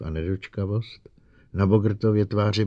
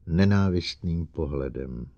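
usual speaking voice

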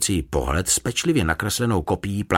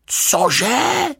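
high, loud outburst of disbelief; loud cry; 5 dB louder than usual. A narrator's reading. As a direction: blurt it out aloud, shocked. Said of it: "vykřikl Paddington nahlas". (hlasitý, užaslý výkřik)

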